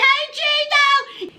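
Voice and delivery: high, slow mock greeting of a friend; imitating the friend. Friend hails Gino to show stripper video, high slow voice. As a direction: call out to Gino in a put-on friend voice.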